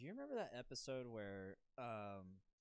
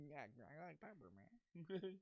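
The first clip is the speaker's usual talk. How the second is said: higher, soft cartoon impression; Murder Face; 6 semitones higher, 4 dB quieter than usual. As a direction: put on a quieter, pitched-up character voice. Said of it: "That's like Murder Face".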